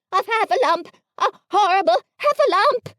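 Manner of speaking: high, loud, frantic screech; terrified squeal. A narrator's reading. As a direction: Piglet screaming at the monster. Piglet terrified at trap.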